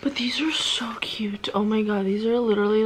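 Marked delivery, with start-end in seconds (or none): 0.0-1.5 breathless whispered gasp over something cute; delighted whisper. Real, not acted. Opening a fan's gift package, a delighted whisper. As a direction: hush it like it's too cute to say aloud.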